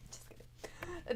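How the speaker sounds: slow soft whisper; a whispered aside. Real, not acted. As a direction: take it back in a hushed whisper. Whispered take-back after a joke.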